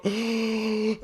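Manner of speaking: high, sharp acted gasp; frightened gasp. Someone freezing at the sight of spiders, frightened gasp.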